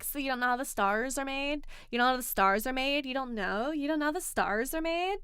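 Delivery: mimicked mean girl, condescendingly repeating herself; mean girl vocal fry. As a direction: exaggerate a disbelieving mean girl, pitch lifting at the ends. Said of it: "on the voice and the vocal fry".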